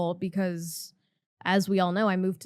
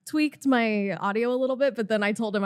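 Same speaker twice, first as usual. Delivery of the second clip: normal talking voice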